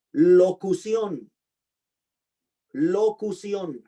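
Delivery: locución said very slowly, stress on the final O, lo-cu-CIÓN; a teacher dictating locución with its accent on the last O; slow careful locución. Shown as a demonstration. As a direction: stretch locución and land on the last O. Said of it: "acento en la última O".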